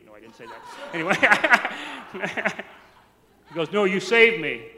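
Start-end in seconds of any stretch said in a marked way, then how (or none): 3.7-4.7 very loud, insistent retort; booming mimicked uncle, refusing to be contradicted; imitated insistence. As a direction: insist on it forcefully, overruling the objection. Uncle insists after being contradicted.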